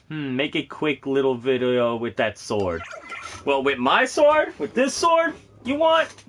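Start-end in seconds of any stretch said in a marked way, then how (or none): 3.5-6.1 playful squeaky high question; mock surprised. Teasing chat about his sword in a high squeaky voice.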